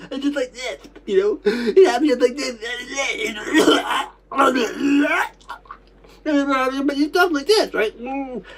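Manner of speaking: high, distressed voice broken by coughing; tearful, choking reliving. Retold or imitated. Recalling being laughed at while coughing, high choked voice. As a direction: relive it upset, catching your breath between coughs.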